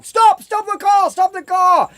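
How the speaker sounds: high, urgent imitation of a panicked passenger; mock panic. Girlfriend to the driver after hitting a rabbit, mock panic. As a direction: yelp it high, hammed-up alarm.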